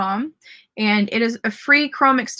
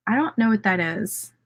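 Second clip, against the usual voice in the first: ordinary delivery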